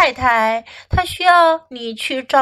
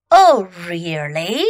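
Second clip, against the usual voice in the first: put-on low, drawn-out questioning of a child; pointed challenge; 7 semitones lower, slower than usual. A narrator's reading. Mom answers Fox's refusal, pointed challenge. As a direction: stretch it out as a mother's warning.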